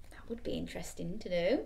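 hushed whisper. Real, not acted. Reader to child, whispered aside. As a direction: breathe it as a secret.

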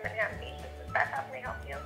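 usual speaking voice

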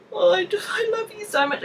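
a re-enacted tearful outburst; retold crying. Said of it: "And I'm crying".

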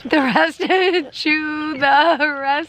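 laughing while repeating. Real, not acted. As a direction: giggle through the words. Laughing repeat of husband's words.

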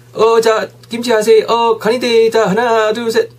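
high, lively photographer counting down; playful high-pitched photo countdown; acted photographer. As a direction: cue a friend's photo in a high, bouncy voice. Acting out taking a friend's photo.

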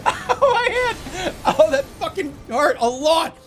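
high, laughing mock ow; hammed-up cry of pain from a game developer. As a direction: ham up a hurt hand. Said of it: "they're like".

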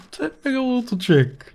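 thrilled. Real, not acted. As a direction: gush over the discovery with rising delight.

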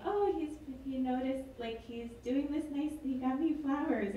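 high, gushing flattered voice; flattered God. Retold or imitated. God on her cloud gushes about Noah's offering in a high voice. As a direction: coo over the flowers like a flattered goddess. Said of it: "God sitting on her cloud being like".

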